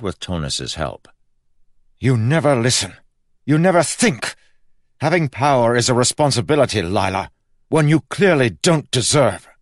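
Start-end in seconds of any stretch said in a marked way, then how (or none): 2.0-9.5 high, loud furious scolding; loud bitter tirade; angry lecture. Acted. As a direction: berate her hard. Kel berating Lila.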